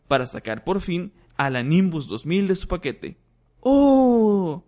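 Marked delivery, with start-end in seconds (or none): 3.6-4.6 high, loud amazed oh; awed sigh. Acted. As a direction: gasp in wonder. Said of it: "suspiró Ron".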